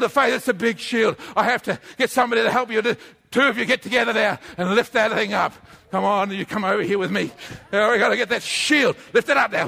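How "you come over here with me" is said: ordinary talk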